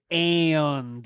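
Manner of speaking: "and" drawn out into ay-un-duh; three-syllable and. Retold or imitated. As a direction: stretch "and" slowly into three syllables. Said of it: "He makes it three syllables".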